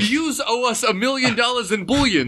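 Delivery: high, hammed-up Brooklyn gangster accent; mock Brooklyn gangster accent. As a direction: lay on a cartoon New York mobster accent. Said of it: "these are atrocious".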